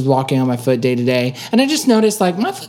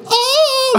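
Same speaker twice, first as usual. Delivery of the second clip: a sudden high squeaked Oh; high pained yelp of Oh; shrill oh. Shown as a demonstration. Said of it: "like that".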